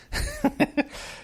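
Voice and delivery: short high chuckle; high laugh. Real, not acted. Laughs after made a splash.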